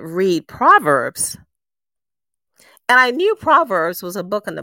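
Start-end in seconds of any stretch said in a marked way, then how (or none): 0.0-1.4 slow, louder, puzzled echo of the command; puzzled she repeats God's words back, slow and a touch louder; baffled echo. Retold or imitated. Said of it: "and I said".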